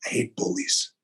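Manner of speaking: hushed, unvoiced first take; intense whisper. Real, not acted. Whispering to the host that he hates bullies. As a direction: whisper it through clenched conviction.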